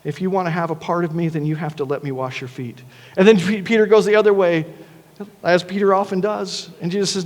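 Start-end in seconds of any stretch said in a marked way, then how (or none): 0.0-2.8 softer, lower, a tender correction; very gently. Retold or imitated. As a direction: correct him softly, like a patient teacher. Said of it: "Jesus very gently says".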